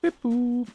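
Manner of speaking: high, loud playful beep boop; robot beeping. Pretend. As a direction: chirp it like a little robot. Mimics a machine beeping.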